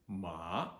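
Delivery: MA starting low and rising high; rising second tone. Shown as a demonstration. Teacher shows second tone, MA rising from low to high. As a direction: swoop MA upward from low to high. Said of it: "starts low in pitch and then rises much higher".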